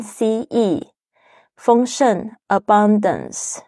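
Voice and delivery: 一字一顿地慢慢念单词和拼写; 像老师带读一样，放慢每个字母; 慢速单词朗读. Shown as a demonstration. Slow vocabulary drill. (老师慢速带读单词)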